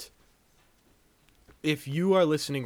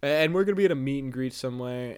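normal talking voice

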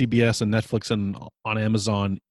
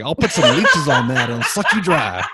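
laughing Southern drawl, louder; hammed-up Southern doctor accent; Southern country doctor; 3 semitones higher, 6 dB louder than usual. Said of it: "Southern country doctor".

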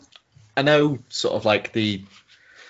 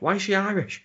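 high-pitched mock disbelief; put-on high voice, querying; comedian's joke imitation; 10 semitones higher than usual. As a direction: quote the comic's baffled question. Quoting Peter Kay's stand-up joke.

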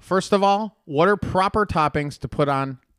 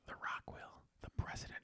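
whisper; 22 dB quieter than usual. Real, not acted. Whispered aside.

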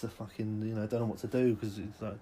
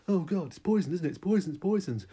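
paranoid panic; 5 semitones higher, 5 dB louder than usual. Acted. Diner sure meal is poisoned.

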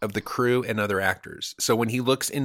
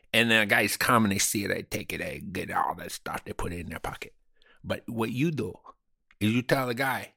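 mock Cuban accent. Comedian bragging to cohost about being inside Hollywood, in a mock Cuban accent. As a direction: play a fake Hollywood Cuban tough guy in a Cuban accent. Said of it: "I was using an accent".

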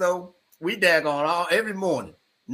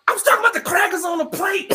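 innocent protest. Retold or imitated. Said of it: "I'm like".

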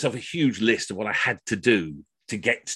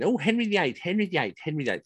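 nagging singsong; 7 semitones higher than usual. Retold or imitated. Friends nagging, fading repeats.

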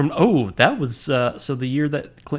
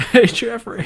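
Bill Clinton impression; 10 semitones higher, 6 dB louder than usual. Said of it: "best Clinton impression".

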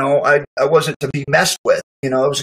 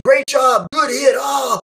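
teammates cheering; 10 semitones higher, slower than usual. Retold or imitated. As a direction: cheer a teammate after a big hit.

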